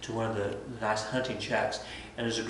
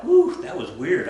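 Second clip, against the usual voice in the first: relieved whew; 7 semitones higher, 7 dB louder than usual. Retold or imitated. Nobles relieved after the whack.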